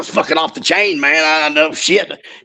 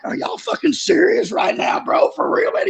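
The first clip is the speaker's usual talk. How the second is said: mock disbelief; 3 dB quieter than usual. Ken's baffled look imitated.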